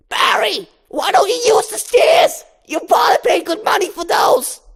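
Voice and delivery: high, loud nagging mom voice; scolding mother. Acted. Mother scolds Barry for sliding banister, high and loud.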